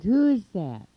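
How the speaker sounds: loud, high demand to an unseen caller; calling out. A narrator's reading. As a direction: call out the question loudly. Monster calls to unseen caller.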